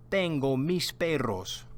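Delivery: Tengo mis perros said clearly, stress on tengo; Spanish model sentence. Shown as a demonstration. Teacher models Carlos's line for students to repeat. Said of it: "Repeat after me".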